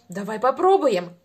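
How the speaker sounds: ordinary talk, nothing marked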